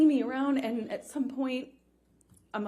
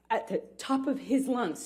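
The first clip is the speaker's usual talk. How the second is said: normal talking voice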